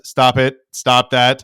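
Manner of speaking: loud, flat stern order; loud German businessmen telling him to quit; stern scolding. Retold or imitated. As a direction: tell him off firmly.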